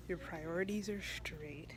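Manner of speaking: soft unvoiced aside; whispered aside. Real, not acted. Shopper in Target whispering to her viewers. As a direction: whisper it like a confession.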